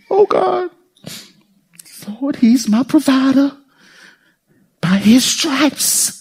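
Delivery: hammed-up anxious prayer; mock pious wail. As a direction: overdo the worried praying. Mocking panicked believer.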